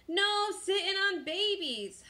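high, slow, drawn-out holler; crew holler. A narrator's reading. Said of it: "hollered the crew".

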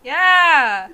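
loud, high, drawn-out yeah; real whoop of success; excited cheer. Real, not acted. Player cheering as the trick works, loud high cheer.

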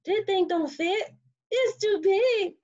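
high-pitched whiny protest; dismayed complaint. Acted. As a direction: protest in a high voice, dragging the end. Character finds gift will not fit.

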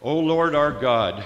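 ordinary delivery

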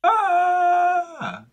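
long, loud high squeal; imitated baby yell. Leo nephew yelling each morning, imitated yell. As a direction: let out a baby's held shriek. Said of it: "you gotta yell".

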